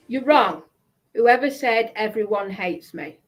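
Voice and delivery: ordinary talk, nothing marked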